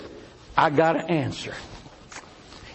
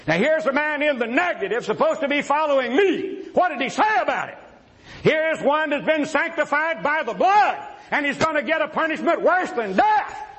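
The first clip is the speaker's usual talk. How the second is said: high-pitched, emphatic pulpit cry; preacher's yell; 6 semitones higher than usual. Real, not acted. Debater challenging the man in the negative, yelling. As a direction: raise the voice and drive the challenge home. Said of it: "I yelled".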